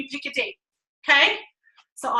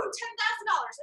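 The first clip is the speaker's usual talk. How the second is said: excited cheer; 8 semitones higher, 11 dB quieter than usual. Shown as a demonstration. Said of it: "I cheer like a crazy person".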